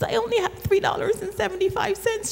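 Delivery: put-on high, fast child's plea; little girl voice. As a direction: squeak it like a small girl. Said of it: "She says".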